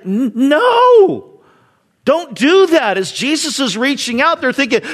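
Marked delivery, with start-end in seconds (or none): 0.0-3.0 alarmed gasp. Retold or imitated. Crowd gasps under breath.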